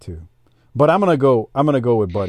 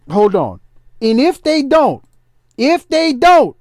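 raised, riled-up promo rant; wrestling promo; 3 semitones higher than usual. Acted. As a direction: cut a fired-up wrestling promo. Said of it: "I'ma cut a promo".

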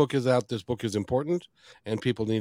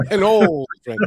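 laughing, higher and louder; 7 semitones higher, 6 dB louder than usual. Real, not acted. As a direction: laugh the words out, amused. Amused at being called old.

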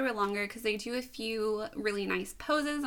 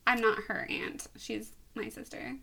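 ordinary delivery

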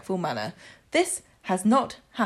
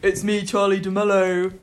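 deeper voice; 4 dB louder than usual. Pretend. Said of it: "I can do that too".

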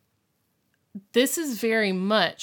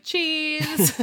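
mock cheese; 8 semitones higher, 4 dB louder than usual. [name]'s sarcastic photo cheese.

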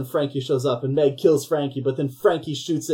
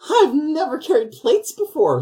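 much higher, slow whiny put-on; mock helpless waitress; 13 semitones higher, 4 dB louder than usual. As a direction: ham up the clueless newbie. Mocking clumsy waitress arc.

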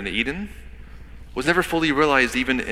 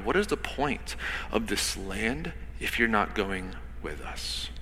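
soft, drawn out; 3 semitones lower, 10 dB quieter than usual. Shown as a demonstration. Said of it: "let that sit for a minute".

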